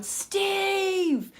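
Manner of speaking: high, excited call across the zoo; mock yell to a passing celebrity; retold shout. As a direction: call out his name as he passes. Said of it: "I just shout down".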